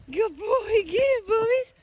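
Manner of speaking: high, playful pet-owner voice; high dog praise. Real, not acted. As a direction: egg the pup on in a high voice.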